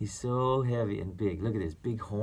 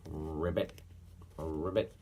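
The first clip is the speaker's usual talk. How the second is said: toad croak; 6 semitones lower, 7 dB quieter than usual. Pretend.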